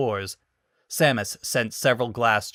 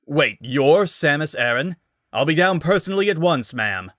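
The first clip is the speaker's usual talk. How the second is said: louder, fast, flustered deference; suddenly eager; 5 semitones higher, 5 dB louder than usual. Acted. Guard realising he is talking to Samus Aran. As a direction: rush it out, suddenly respectful.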